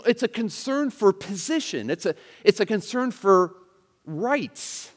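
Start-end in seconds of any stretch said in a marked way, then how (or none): none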